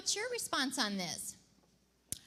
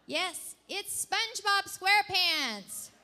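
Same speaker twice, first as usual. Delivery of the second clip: loud, slow, drawn-out reveal; grand reveal; 5 semitones higher, 7 dB louder, slower than usual. Acted. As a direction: announce it like a showstopper. Host's big reveal.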